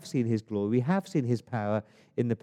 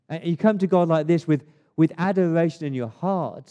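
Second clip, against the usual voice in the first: loud, raised-pitch, impassioned preaching; fervent, forceful sermon delivery; full-force preaching; 5 semitones higher, 6 dB louder than usual. Real, not acted. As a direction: cry it out to God before the congregation.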